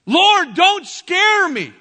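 loud, slow, high cry to the Lord; shouted plea. Retold or imitated. Frightened man crying out to the Lord, slow loud plea. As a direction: cry out slowly, begging the Lord. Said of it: "I shout out these words".

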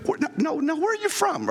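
high-pitched imitation of a curious stranger; puzzled questioner. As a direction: act out someone insistently asking, pitched well up. Acted curious listener noticing an accent.

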